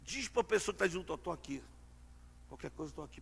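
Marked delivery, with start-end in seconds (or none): 1.1-3.2 soft comfort. Shown as a demonstration. Advised comforting words.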